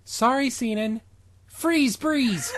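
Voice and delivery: high, mock-heroic spell cry; hammy spell. Comedy podcast reading a cringey spell, hammed up. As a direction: cast it like a cheesy superhero.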